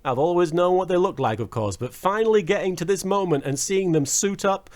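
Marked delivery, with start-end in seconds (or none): none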